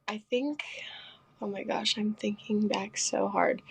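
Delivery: soft whisper while searching her memory; whispered thinking. Real, not acted. Trying to recall her old roles, softly whispering. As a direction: breathe it out, lost in thought.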